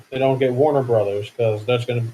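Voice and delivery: normal talking voice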